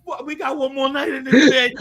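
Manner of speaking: laughing, half-crying groan; laughing dread. Retold or imitated. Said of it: "I was like".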